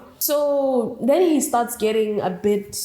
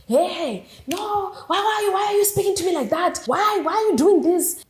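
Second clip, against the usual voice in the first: high-pitched mock outrage; acted-out indignant protest; suddenly mad; 8 semitones higher than usual. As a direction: imitate his affronted, rapid questioning. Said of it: "all of a sudden the energy shifts".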